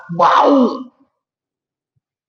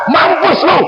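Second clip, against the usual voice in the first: high, loud mock rant of a furious father; hammed-up angry outburst, shouted high; mock furious yell; 4 dB louder than usual. Preacher acts out an angry father cursing his drowned son. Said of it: "Apakah kemudian Nabi Nuh marah?".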